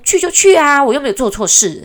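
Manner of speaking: loud, high indignant outburst; acted mother's defiant shout; defiant retort.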